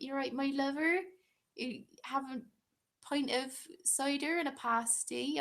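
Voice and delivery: broad, exaggerated West Country voice; West Country accent. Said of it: "a West Country accent".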